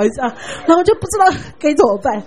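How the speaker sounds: ordinary delivery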